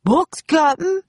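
high henchman's shout, rising at the end. A narrator's reading. Pinky reports from the treehouse, shouting down. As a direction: call it down from above, pitched high. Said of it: "Pinky shouted down".

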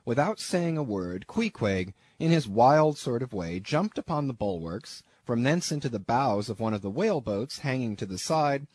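ordinary delivery, nothing marked

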